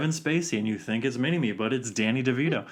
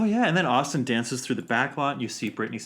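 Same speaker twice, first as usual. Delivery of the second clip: normal talking voice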